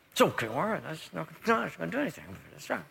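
mumbled, soft mock resignation; muttered brush-off. Mimics Christians who stuff it.

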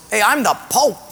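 put-on comic Portuguese accent, proudly announcing it. Mental patient claiming to be Pope, comic Portuguese accent. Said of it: "One Portuguese guy goes".

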